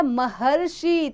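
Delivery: महर्षि drawn out slowly, each syllable clear; slow spelled-out word. Shown as a demonstration. Teacher showing pupils the sound, slow and clear. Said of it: "तो उच्चारण में क्या निकला?".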